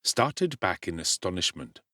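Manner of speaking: ordinary delivery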